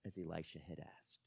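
soft unvoiced whisper. A narrator's reading. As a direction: breathe the line out. Closing whisper.

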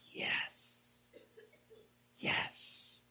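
hushed whispered yes; soft whisper. Retold or imitated. Preacher voicing Jesus whispering assent to Peter. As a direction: breathe the yes gently.

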